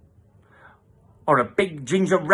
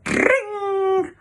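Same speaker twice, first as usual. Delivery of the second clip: ordinary delivery, nothing marked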